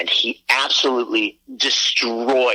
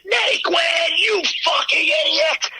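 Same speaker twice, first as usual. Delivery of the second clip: furious shout, much higher; screamed at idiot; slower than usual. Retold or imitated. At a fielder who dropped the ball, a raging scream. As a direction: yell it out at the top of your lungs. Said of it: "I screamed at the top of my lungs".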